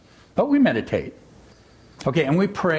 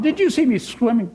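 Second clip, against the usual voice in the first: squeaky Roo; 8 semitones higher than usual. A narrator's reading. Roo voiced.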